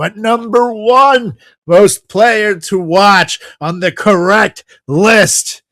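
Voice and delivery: slow, drawn-out reveal of number one; countdown reveal. Acted. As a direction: build it up like a game-show host.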